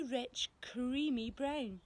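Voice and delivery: ordinary delivery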